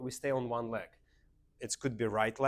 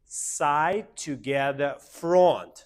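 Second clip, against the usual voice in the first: loud, higher calls, one word per beat; rhythmic step calls; 3 semitones higher, 10 dB louder, much slower than usual. Shown as a demonstration. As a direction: punch out FRONT loudest. Teacher calls steps on the beat.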